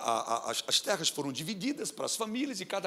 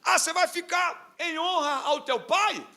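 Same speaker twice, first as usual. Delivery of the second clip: high, loud doubting question; imagined doubter questioning Samar's reason for staying; skeptical challenge; 12 semitones higher, 5 dB louder than usual. Retold or imitated. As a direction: push back with disbelief. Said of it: "alguém poderia pensar assim".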